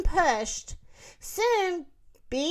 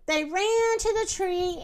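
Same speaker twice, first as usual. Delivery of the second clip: usual speaking voice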